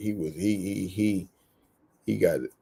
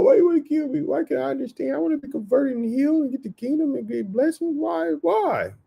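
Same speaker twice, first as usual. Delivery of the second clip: pitched way up, crying out why you wanna kill me; high whiny pleading. Acted. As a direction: beg in a high wailing mock voice. Same pleading voice resumed.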